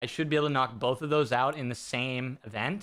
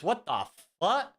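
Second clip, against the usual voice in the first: shocked disbelief; 5 semitones higher, slower than usual. Real, not acted. Shocked at viewer count.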